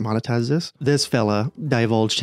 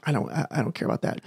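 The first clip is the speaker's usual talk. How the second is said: mumbled mock ramble; 3 semitones higher, 7 dB quieter, faster than usual. Mocking the guy's rambling.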